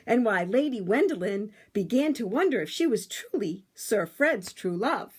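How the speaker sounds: put-on lively read-aloud for children; storybook narration. A narrator's reading. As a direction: tell it like a bedtime story to a class.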